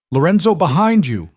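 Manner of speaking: high loud warning scream. A narrator's reading. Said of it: "Elena's scream cut through the chaos".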